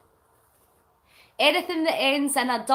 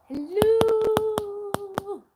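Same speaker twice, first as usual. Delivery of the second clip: signal check; 9 semitones higher, 3 dB louder than usual. Real, not acted. Testing a dropped signal.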